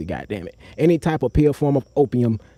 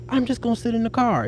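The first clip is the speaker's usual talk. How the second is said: high retold line; 8 semitones higher, 3 dB quieter than usual. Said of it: "I'm like".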